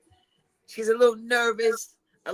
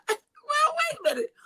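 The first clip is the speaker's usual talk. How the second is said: high mock voice. Put-on high voice.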